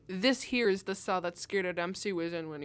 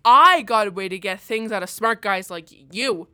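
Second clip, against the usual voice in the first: much louder menacing threat; 11 dB louder than usual. Acted. Cop loudly threatens the smart-mouthed prisoner. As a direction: lean in loud and threatening.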